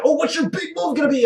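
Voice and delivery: high, far-off mocking voice; mock question voice. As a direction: toss it up like a silly question. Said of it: "Scott Steiner was like".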